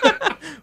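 sudden high-pitched laugh. Real, not acted. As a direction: burst out laughing. Burst of laughter.

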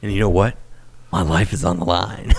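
low dramatic announcer line; trailer-style dramatic read; promo voice. Retold or imitated. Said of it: "the promo that you did".

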